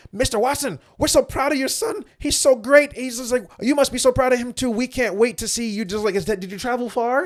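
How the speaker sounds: high, sugary sucking-up voice; fawning flattery. Retold or imitated. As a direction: grovel sweetly like a private before a general. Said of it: "like privates to a four-star general".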